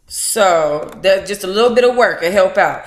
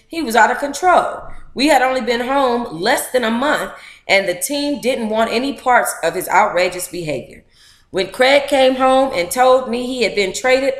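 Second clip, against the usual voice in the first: lively read-aloud, a bit higher than her chat; reading a memoir aloud; 4 semitones higher than usual. Acted. Streamer reading a book chapter to her audience, lively.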